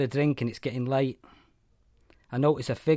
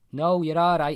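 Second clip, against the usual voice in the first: lightly voiced posh Scouse accent, a bit higher; a touch of refined Liverpool; mild posh Scouse, polite and dismissive; 4 semitones higher, 7 dB louder than usual. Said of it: "He's got a Scouse accent. A posh one".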